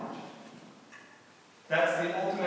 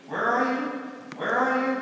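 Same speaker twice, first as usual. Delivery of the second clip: higher, louder calls of a lost driver; lost aunt calling; 10 semitones higher, 5 dB louder than usual. Retold or imitated. Aunt lost on the way, calling out. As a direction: call out anxiously on the phone. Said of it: "Just like".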